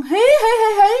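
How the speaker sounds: high "hí hí" ghost laugh; imitated ghostly cackle. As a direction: cackle in high, separate hee-hees. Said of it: "Mà bà cười nhiều điệu lắm". (giả tiếng ma cười hí hí)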